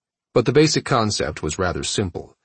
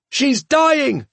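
high desperate cry; 5 dB louder than usual. Acted. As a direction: cry out for help. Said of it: "Cha cried".

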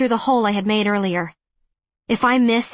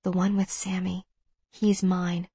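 low, soft, voiced murmur; hushed whisper; 3 semitones lower, 7 dB quieter than usual. A narrator's reading. Narrator whispers to Ben about the silencer, hushed. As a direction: murmur it low under the breath. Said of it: "And I whisper to Ben Parrish".

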